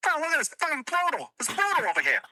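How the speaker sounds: mock Bill Burr rant, fast and high; Bill Burr impression. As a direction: do Bill Burr ranting about something brutal. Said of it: "give me five words from Bill Burr".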